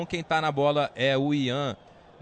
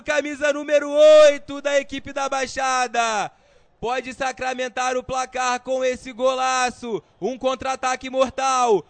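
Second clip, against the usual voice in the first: stretched, much higher and louder goal call; explosive goal commentary; 10 semitones higher, 12 dB louder, slower than usual. Real, not acted. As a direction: scream the goal, draw out every word. Goal scored, commentator erupts. (grito de gol explosivo)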